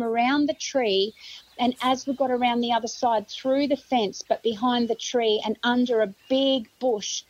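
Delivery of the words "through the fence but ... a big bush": ordinary delivery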